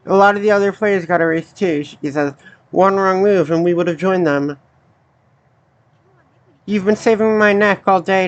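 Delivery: low, even voice reading the characters' lines; reading game dialogue. Acted. Reads game dialogue aloud.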